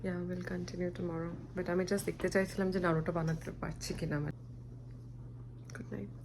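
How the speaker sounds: fading into sleep, eyes closing; nodding off. Real, not acted. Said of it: "চোখ খুলে রাখতে পারছি না".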